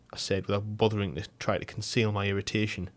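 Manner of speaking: ordinary delivery, nothing marked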